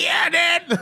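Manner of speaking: loud, high, laughing yell of triumph; excited yell. Retold or imitated. Fan shouting after catching the drummer's stick, hyped and laughing. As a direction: shout it like you just caught the stick.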